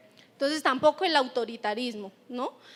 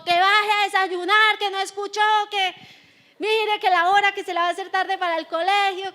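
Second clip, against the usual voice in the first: mock yelling up the stairs, higher and louder; morning nagging yell; 4 semitones higher, 5 dB louder than usual. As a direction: hurry the kids for school like a frazzled parent. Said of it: "nos la pasamos es gritando". (gritos de mamá apurada por la mañana)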